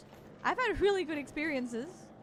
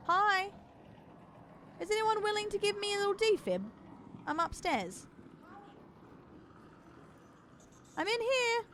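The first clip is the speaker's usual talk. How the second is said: yelling across the room; 8 semitones higher than usual. Real, not acted. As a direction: call out to be found. Still calling for a defib.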